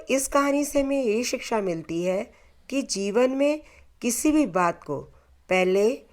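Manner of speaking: plain reading voice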